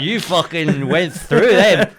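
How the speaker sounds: loud, slow relish; gleeful awe. Retold or imitated. Friend telling comic about the rant, loud relish.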